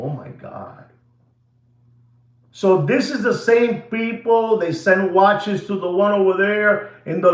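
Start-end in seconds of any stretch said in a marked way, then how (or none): 0.0-0.8 low, breathy-soft awed oh my God; hushed shocked gasp. Retold or imitated. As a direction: murmur it in stunned disbelief. Imitates own shock.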